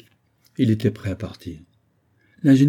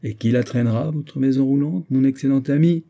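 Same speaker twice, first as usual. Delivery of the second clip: usual speaking voice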